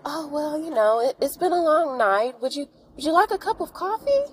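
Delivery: butter her up in a sugary voice; syrupy fake sweetness. Nosy neighbors sweet-talking upset Penny to milk her for gossip.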